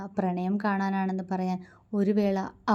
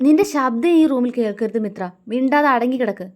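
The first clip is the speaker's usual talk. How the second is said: loud, higher furious command; angry shout; 5 semitones higher, 10 dB louder than usual. A narrator's reading. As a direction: bark it to silence her. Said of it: "ദേഷ്യത്തോടെ പറഞ്ഞു".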